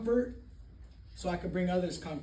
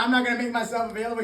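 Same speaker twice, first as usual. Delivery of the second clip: fast stubborn refusal; 3 semitones higher, 6 dB louder, faster than usual. Retold or imitated. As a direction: rattle it off fast and louder. Retold refusal to sponsor.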